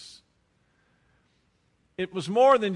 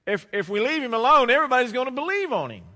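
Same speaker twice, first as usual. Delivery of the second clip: high, loud, rushed fretting; worked-up mock worry, hurried at the end; mock alarm; 7 semitones higher, 5 dB louder, faster than usual. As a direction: imitate a council in a panic, stammering the start. Acting out the worried council.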